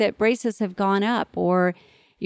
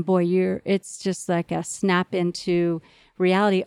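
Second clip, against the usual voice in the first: normal talking voice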